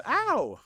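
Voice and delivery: high, loud mock "ow"; acted yelp of pain. Teacher imitating the shocked person next door, mock pained yelp. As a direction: cry out as if zapped. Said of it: "you would hear in the other room".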